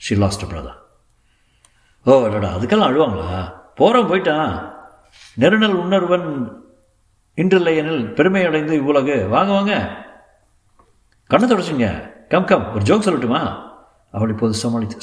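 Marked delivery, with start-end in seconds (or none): 2.0-13.7 high, lively cajoling voice; jolly her out of her tears; breezy junior lawyer, consoling. Acted. Junior lawyer cheering up a bereaved woman, breezy.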